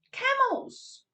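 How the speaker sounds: high, delighted squeal of the word; surprised reveal. Acted.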